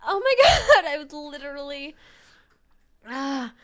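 really laughing to tears; crying with laughter. Real, not acted. Recovering from choking on the joke, tearful with laughter. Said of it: "I am crying".

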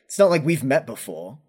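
a knight raising his voice in indignation; indignant knight. Acted. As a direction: protest loudly, affronted. Arthur argues indignantly.

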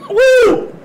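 high whoop after coughing; cough then whoop. Real, not acted. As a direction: cough hard, then cry out woo. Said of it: "we're choking up here".

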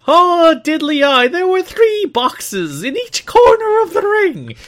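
high, slow hammed-up Irish accent. Co-host asks for his thickest Irish accent, so a hammed-up Irish brogue. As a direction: ham up a cartoon Irish brogue, drawn out high. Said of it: "Please say it in your thickest accent possible".

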